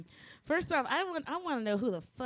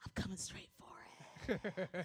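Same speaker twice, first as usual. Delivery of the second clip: soft whispered menace, slowing down; sneaky whisper; 4 semitones lower, 11 dB quieter, much slower than usual. Retold or imitated. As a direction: whisper it like a schemer spotting weakness. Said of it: "who's always like".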